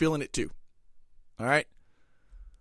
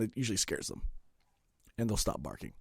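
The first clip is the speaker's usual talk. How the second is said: usual speaking voice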